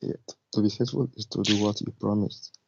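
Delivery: ordinary delivery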